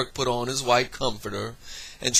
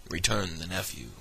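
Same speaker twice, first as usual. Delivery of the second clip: ordinary delivery, nothing marked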